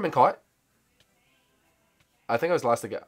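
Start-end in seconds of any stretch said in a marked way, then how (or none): none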